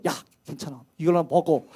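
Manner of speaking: very soft, hushed and tender; tender comfort. Retold or imitated. Angel stroking the prophet and urging him to eat, softly tender. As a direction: coax him gently to eat. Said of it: "어루만지면서 이러되".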